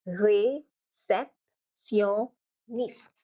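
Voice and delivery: réceptionniste very slowly, ré, cep, tion, niste; drawn-out French réceptionniste with long gaps; syllable by syllable. Shown as a demonstration. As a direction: stop after each syllable of réceptionniste. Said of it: "you stop, huh?".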